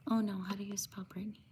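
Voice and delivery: quick, low whisper to oneself; hushed, hurried self-talk; whispered aside. Real, not acted. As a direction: whisper it fast, as if thinking aloud. Muttered aside to self while typing.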